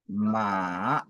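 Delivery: "ma" dipping low, then rising; long low dip on "ma"; third tone. Shown as a demonstration. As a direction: sink on "ma", then swing it upward. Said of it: "le troisième ton".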